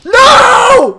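high, loud drawn-out scream. Real, not acted. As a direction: cry out in dismay. Cries out at game setback.

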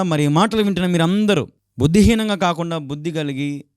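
ordinary talk, nothing marked

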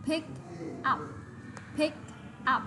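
each word apart, slowly; slow reading. Shown as a demonstration. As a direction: read "Pick up" word by word, slowly. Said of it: "Đấy là đọc chậm này".

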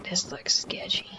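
soft, whispery, halting; hushed wariness. Real, not acted. Player eyeing where bad guys would live, hushed. Creeping into a sketchy place.

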